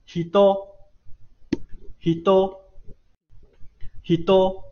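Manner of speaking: hido stepping up in pitch; rising pitch accent. Shown as a demonstration. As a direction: model hido with a rising second syllable. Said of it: "这个单词是往上升的".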